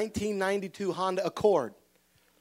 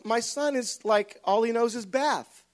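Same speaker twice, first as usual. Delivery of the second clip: usual speaking voice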